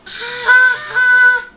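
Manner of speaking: high, very loud mock shriek; imitated scream. Child retelling the story screaming like Elephant.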